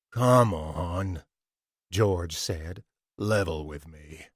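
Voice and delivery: thug leaning on his captive; low menacing thug. A narrator's reading. George menaces again.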